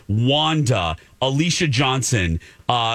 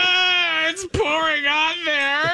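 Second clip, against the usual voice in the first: high, slow squeal of disgust; high pitched squeal; slower than usual. Real, not acted. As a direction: squeal as the sauce pours out. Sauce poured onto bowl.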